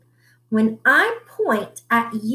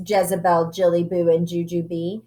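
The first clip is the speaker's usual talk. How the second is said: ordinary delivery, nothing marked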